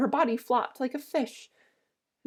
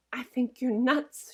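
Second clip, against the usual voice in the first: slow; 3 semitones higher, much slower than usual. Acted. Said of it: "moved away from him".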